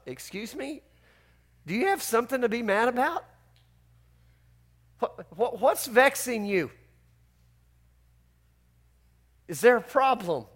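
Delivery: soft, hushed, patient asking; gentle questioning. Retold or imitated. God gently questions sulking Jonah, soft and patient. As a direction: ease the question out gently, no edge. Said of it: "I love the way the Lord mediates".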